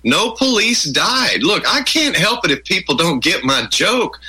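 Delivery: mock exasperated friend telling them off; imitating exasperated friend. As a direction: scold them for being slow. Friend scolding the complainers.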